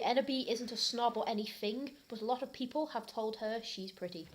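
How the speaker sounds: ordinary delivery, nothing marked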